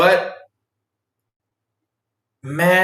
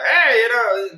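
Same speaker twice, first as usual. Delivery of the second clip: sweet-talking the painting; 9 semitones higher than usual. Retold or imitated. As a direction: coo at the portrait so she stays friendly. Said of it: "be like".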